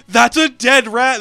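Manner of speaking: high, loud, slowed yell; mock yell. Mikey shouting at Donnie over the rat, loud mock yell. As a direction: bellow it at your brother. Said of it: "And Mikey's like".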